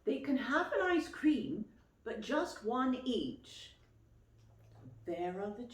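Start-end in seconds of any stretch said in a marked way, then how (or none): 0.0-3.8 higher, louder reading of the list. Acted. Reading Mummy's instructions to the aunt, a bit louder. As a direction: read out Mummy's instructions firmly.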